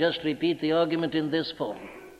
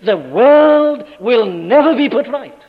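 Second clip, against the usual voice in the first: slow, loud pulpit thunder; thundering proclamation; 6 semitones higher, 10 dB louder, slower than usual. Acted. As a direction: boom it out as final judgment. Loudest pulpit climax.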